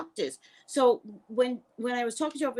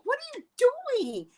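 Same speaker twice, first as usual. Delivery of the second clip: alarmed cry. Retold or imitated. Alarmed at doctor mid pap smear.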